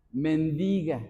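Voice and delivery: mendiga stressed on the I, men-DI-ga. Shown as a demonstration. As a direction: say mendiga slightly low, with the accent on DI, not on MEN. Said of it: "No hay que ponerle el acento en la E".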